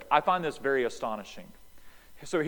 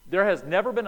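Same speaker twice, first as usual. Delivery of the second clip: ordinary delivery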